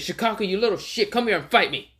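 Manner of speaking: high taunting call-out; cocky challenge. Acted. Naruto taunting Shukaku to fight, pitched high. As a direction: dare him to fight. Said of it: "pushed Gaara out of the way and says".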